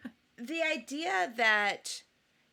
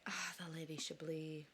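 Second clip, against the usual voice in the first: whispered admiration; 7 semitones lower, 15 dB quieter than usual. Real, not acted. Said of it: "fond sigh".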